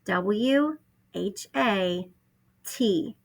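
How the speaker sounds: letters drawn out one by one; spelled out slowly. Shown as a demonstration. Teacher spelling what slowly for first graders. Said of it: "spells what".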